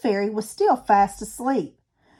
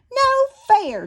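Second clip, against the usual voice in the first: put-on childish protest; 12 semitones higher, 3 dB louder than usual. A narrator's reading. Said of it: "Chomp said".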